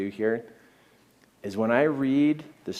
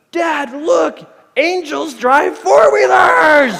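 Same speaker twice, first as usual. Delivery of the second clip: put-on high, full-volume boyish shout, drawn out; excited child's yell; 13 dB louder, much slower than usual. Son spotting rescuers on four-wheelers, awestruck yell. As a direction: yell it in amazement, stretching the last word. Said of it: "the next thing he yelled out".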